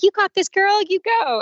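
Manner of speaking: high, perky cheerleading angel; cheering shoulder angel. Acted. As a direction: egg her on in a high voice. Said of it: "I had an angel on one shoulder".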